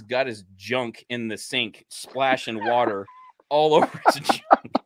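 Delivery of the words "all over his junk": laughing. Real, not acted. Laughing while telling.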